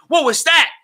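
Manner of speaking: high, loud startled cry; alarmed shout. Retold or imitated. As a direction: imitate someone yelling out in alarm. Retold Chris reacts in alarm.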